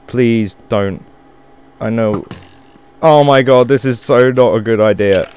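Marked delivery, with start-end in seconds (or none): none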